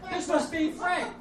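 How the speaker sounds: put-on high sing-song drunk mom. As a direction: play his boozy mother greeting him. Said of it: "I'd come downstairs in the morning and go".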